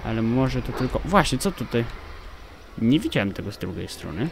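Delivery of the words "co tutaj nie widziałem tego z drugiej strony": ordinary delivery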